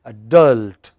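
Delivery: "Adult" as a-DULT, DULT loud and falling; clearly stressed syllable. Shown as a demonstration. Teacher models the word for the class, stressed on second syllable. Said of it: "stressed syllable must come out very clearly".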